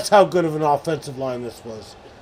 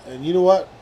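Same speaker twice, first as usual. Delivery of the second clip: normal talking voice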